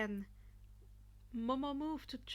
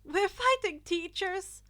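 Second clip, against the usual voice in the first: high, loud alarmed shout; excited cry; 8 semitones higher, 10 dB louder than usual. A narrator's reading. Uraraka alarmed at facing the teachers, loud excited cry. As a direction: cry it out in shock. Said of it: "Uraraka cried".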